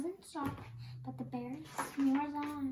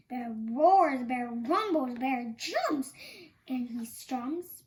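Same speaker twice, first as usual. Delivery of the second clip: roaring bear; 8 dB louder than usual. A narrator's reading. Narrator acts out angry bear.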